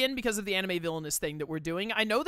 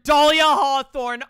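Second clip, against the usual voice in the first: dramatic loud reveal; 7 semitones higher, 15 dB louder, much slower than usual. Acted.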